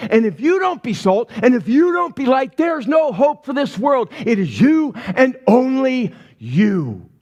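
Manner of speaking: "you" and "only" hit hard, pitched high; emphatic stress. Shown as a demonstration. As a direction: punch every "you" and "only", slowing into a final "you". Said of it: "when you hear it said that way".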